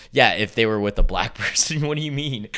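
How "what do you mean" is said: ordinary delivery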